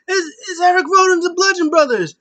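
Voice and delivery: high, loud, near-crying excitement. Retold or imitated. Commentator losing it over a surprise return, high excited shout. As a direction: shriek it like a thrilled commentator. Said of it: "[name] got excited. He was like".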